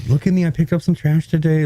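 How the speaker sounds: high, smug show-off voice; mock a bragging do-gooder; mocking showoff voice.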